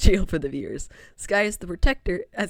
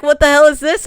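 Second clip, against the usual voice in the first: loud confused outburst; 7 semitones higher, 11 dB louder than usual. Acted. Confused new viewer voiced.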